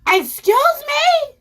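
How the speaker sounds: high, slow, loud "Excuse me?"; shocked. Retold or imitated. Comic outraged at the man's request, high loud disbelief. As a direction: stretch it out in disbelief.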